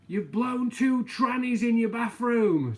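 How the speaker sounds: loud, high, drawn-out holler; shouting down. Retold or imitated. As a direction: yell it down from the loft to someone below. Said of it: "he shouted down".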